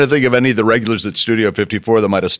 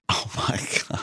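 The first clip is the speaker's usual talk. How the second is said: whispered amazement; 13 dB quieter than usual. Real, not acted. Amazed at the Rolls Royce.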